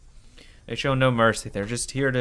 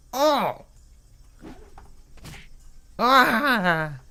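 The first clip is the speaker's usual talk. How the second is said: high, loud pain screams fading out; agonized screams; screaming; 6 semitones higher, 3 dB louder, much slower than usual. Acted. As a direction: scream until passing out. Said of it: "losing my consciousness while screaming".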